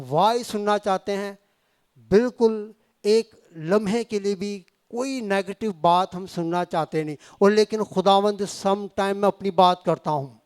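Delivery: ordinary talk, nothing marked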